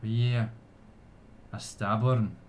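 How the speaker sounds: measured ceremonial address to a gathering; solemn, projected speech of thanks; elf orator. Acted. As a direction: proclaim it to your people with pauses. Elf's ceremonial speech continues.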